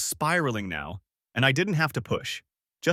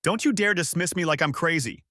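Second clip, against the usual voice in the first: screeching; 6 semitones higher than usual. A narrator's reading. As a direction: screech it, losing control. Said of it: "Her voice cracked into a screech".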